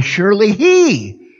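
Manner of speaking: "he" lifted and held in "Surely he"; stress on he. Shown as a demonstration. Preacher going through the verse word by word, stressing "he". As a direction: land on "he" and stretch it. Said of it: "Just emphasize each word".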